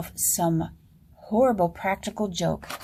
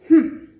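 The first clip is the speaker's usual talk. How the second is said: loud sharp hmm; a rude grunt to send them off; 5 semitones higher, 10 dB louder than usual. Acted. Jester grunts at the king's counselors, loud.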